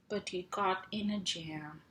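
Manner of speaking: usual speaking voice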